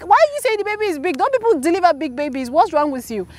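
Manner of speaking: high, fast, indignant outburst; mother in labor snapping at the midwife, high and fast; retold exasperated yell. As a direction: rattle it off high and fed up. Retelling arguing with midwife in labor.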